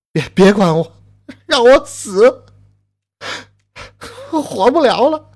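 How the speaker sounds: loud, high, hopeless plea; despairing cry. Acted. Grieving husband begging his rescuer to leave him, loud despair. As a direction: beg to be left to die.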